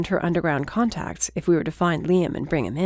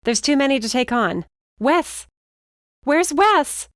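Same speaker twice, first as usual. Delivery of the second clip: high, loud panicked calling; frantic; 8 semitones higher, 5 dB louder than usual. Acted. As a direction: call out for Wes in panic. Mercy frantically looks for Wes.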